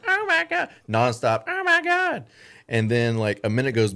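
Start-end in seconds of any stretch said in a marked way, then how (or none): 0.1-0.7 very high squealing Oh my God; shrieking woman. Retold or imitated. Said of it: "a woman in the camera going". 1.5-2.2 very high squealing Oh my God; shrieking woman. Retold or imitated. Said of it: "a woman in the camera going".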